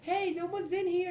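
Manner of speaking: very high put-on girl voice; brothel girl. Game master voices brothel girl leaving room, high voice. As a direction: call out in a high, complaining pitch. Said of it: "one of the brothel girls".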